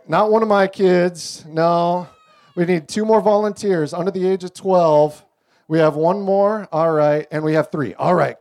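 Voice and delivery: normal talking voice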